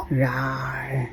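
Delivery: low, soft mock roar; a small growly lion sound for the child. Shown as a demonstration. Mom roaring softly for toddler's lion. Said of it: "What is it?".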